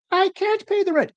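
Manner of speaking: high pleading damsel in distress. Pretend. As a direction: squeak it out like a helpless heroine. Melodrama victim pleading.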